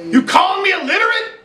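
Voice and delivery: put-on high, defensive kid; paranoid accusation. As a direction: hurl the accusation.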